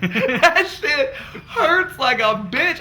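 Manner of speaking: high, slow, laughing through the words; cracking up while telling it. Real, not acted. Telling friends about the toilet, laughing hard.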